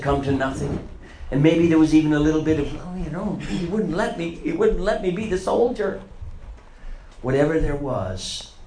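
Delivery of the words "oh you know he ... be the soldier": high, whiny grumble; petulant excuse. Retold or imitated. Peter grumbling that Jesus wouldn't let him fight, petulant. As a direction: sulk that he was stopped.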